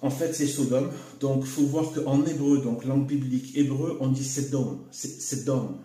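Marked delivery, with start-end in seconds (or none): 4.2-5.8 "Sedom" said Hebrew-style, "sèd-DOM"; prononciation hébraïque. Shown as a demonstration. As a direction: say "Sedom" with an open è and a sounded d. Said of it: "avec la prononciation phonétique comme ça". (« Sedom » prononcé à l'hébraïque, « sèd-dom »)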